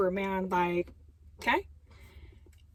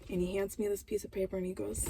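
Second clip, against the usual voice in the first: ordinary talk, nothing marked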